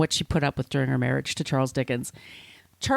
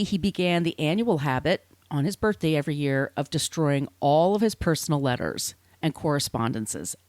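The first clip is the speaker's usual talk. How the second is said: ordinary talk, nothing marked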